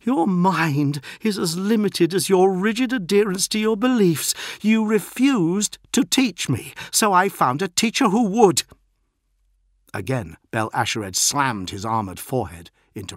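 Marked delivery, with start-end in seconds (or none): 0.0-8.6 high, loud scornful outburst; defiant pupil. A narrator's reading. Beaten postulant accuses his mentor, loudly defiant. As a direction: throw it back at the mentor, high and forceful.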